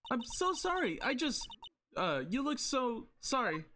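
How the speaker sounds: put-on a jumpy young girl calling out; Maya voice. Maya nervously asking Nick about the trailer noise.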